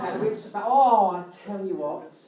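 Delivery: ordinary talk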